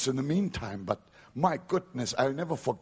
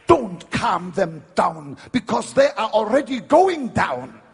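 loud, high preaching rebuke; forceful rebuke; 7 semitones higher, 10 dB louder than usual. Retold or imitated. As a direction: drive the point home hard.

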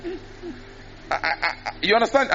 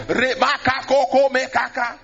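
mock tongues; 5 semitones higher than usual. Mimicking someone starting tongues.

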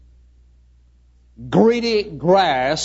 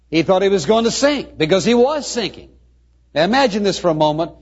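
ordinary talk

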